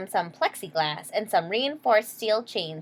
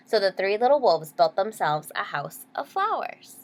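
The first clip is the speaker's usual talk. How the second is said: plain reading voice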